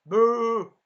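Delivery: mock boo. Real, not acted. Booing the CGI for viewers, loud jeer. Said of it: "Three hundred minus points for C G I".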